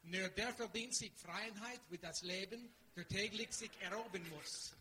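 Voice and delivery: measured German with careful consonants; German recitation. Shown as a demonstration. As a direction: declaim it in German. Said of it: "here's one actually in a different language".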